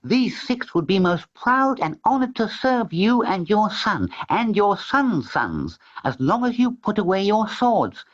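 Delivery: high, gentle cat voice; talking cat. A narrator's reading. Tom the cat addressing the Sultan, high and gentle. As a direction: speak as a polite, wise cat.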